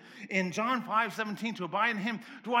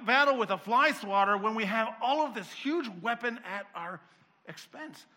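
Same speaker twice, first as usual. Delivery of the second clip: ordinary talk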